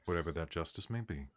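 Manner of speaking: low, soft, solemn elder; grave low NPC. Acted. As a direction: speak low and heavy like an old sage.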